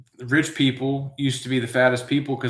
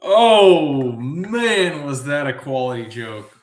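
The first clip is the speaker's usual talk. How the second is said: loud "oh" settling into a chuckle; laughing at own joke; 6 dB louder, slower than usual. Real, not acted. Host after an element pun, laughing with self-congratulation. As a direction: burst out, tickled by your own pun.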